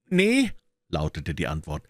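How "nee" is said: put-on much higher, cheeky boy's voice; street urchin. A narrator's reading. Wiggins answering Holmes as a cheeky urchin. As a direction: pitch it up like a ragged street kid.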